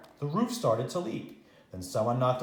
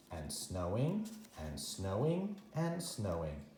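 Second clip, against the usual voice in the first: soft, slow repeated fade; trailing softly; 5 semitones lower, 9 dB quieter, slower than usual. A narrator's reading. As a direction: let each snowing drift away softly. Snow goes on and on.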